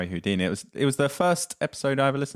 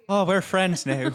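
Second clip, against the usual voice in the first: put-on slow, high Irish accent; 9 semitones higher, 4 dB louder, slower than usual. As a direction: play a delighted Irish person. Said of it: "just do an Irish accent. They're like".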